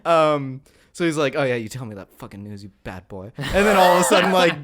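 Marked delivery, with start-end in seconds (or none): 1.4-3.3 sprawled on the couch, barely bothering; lazy low Robin. Retold or imitated. Robin voiced again, lounging.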